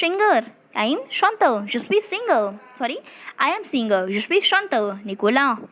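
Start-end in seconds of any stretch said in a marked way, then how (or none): none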